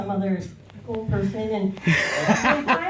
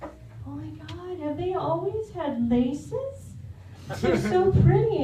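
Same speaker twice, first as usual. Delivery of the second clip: awed gush; 5 semitones higher, slower than usual. Retold or imitated. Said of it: "I'm just like".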